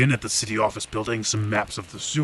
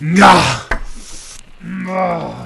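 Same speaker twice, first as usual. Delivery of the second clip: frustrated; 9 semitones higher, 9 dB louder than usual. Acted. Frustrated outburst at bad news.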